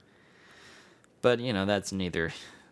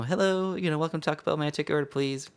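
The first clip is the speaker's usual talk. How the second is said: high-pitched imitation of a woman taking orders; female employee; 7 semitones higher, faster than usual. Drive-thru employee greets a customer at Taco Bell, high female voice. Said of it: "it's a female, uh, uh, employee".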